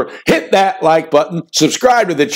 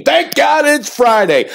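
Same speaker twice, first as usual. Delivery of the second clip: excited opener; 10 semitones higher, slower than usual. Real, not acted. Opening catchphrase of the show.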